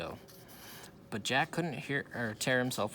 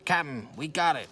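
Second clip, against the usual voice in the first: shouted; 4 semitones higher, 6 dB louder than usual. A narrator's reading. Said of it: "shouted Pinky".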